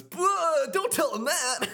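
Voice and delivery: high put-on voice for Beat; playful Beat impression. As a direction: play Beat, waving it off. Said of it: "And then Beat goes".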